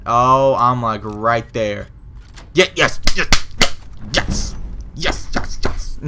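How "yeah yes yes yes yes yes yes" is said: triumphant. Real, not acted. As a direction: cheer as the lock opens. Lock finally opens.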